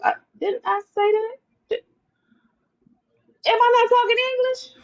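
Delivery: mock indignant. Mock outrage retelling his reply.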